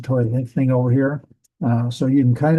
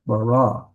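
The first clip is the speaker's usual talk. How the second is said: slow bara, second syllable stressed; stress on last syllable. Shown as a demonstration. Teacher repeating the correct reading, bara stressed on the end. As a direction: land on the final "ra" in bara. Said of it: "the accent mark on that last syllable".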